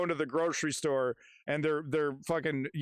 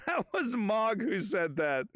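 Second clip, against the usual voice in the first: laughing; 6 semitones higher than usual. Real, not acted. Laughing at own mix-up.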